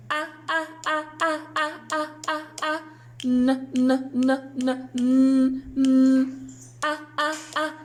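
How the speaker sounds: short, evenly spaced "Ah" and "n" sounds; letter sounds. Shown as a demonstration. Teacher leading children in letter sounds, chanted one by one. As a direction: sound out each one separately, "Ah" short, "n" hummed. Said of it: "say the sounds with me".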